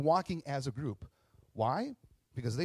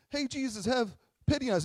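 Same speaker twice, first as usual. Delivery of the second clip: high-pitched mock begging; pitiful pleading; 10 semitones higher, 4 dB louder than usual. Lepers begging Jesus for pity, pitiful pleading. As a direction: plead in a hammed-up poor-me voice. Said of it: "like, I think of".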